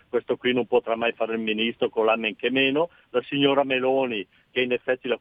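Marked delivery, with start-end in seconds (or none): none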